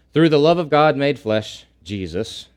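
ordinary talk

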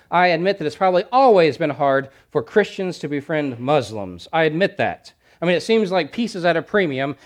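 emphatic pulpit challenge; fervent preaching. Acted. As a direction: press the congregation hard. Forceful preaching passage.

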